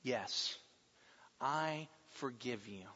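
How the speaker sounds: gentle forgiveness. Acted. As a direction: say it quietly, meaning it. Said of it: "And we say to them".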